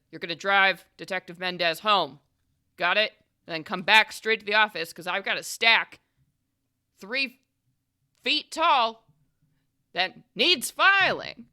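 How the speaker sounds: loud orders, rising as they build; stern boss. Acted. Boss hands a rookie his chores, loud and commanding. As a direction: pile on the orders with heavy pauses.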